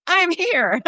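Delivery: high, bright call-out; cheery announcement. Retold or imitated. The living honoree calls out to the room, high and cheerful. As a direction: pipe up from the crowd. Debbie stands up announcing herself.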